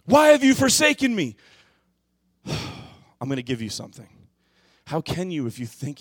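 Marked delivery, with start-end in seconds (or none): 0.0-1.4 much higher and louder cry of abandonment; loud desperate plea; anguished cry. Acted. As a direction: shout the question to heaven. Cry repeated at climax.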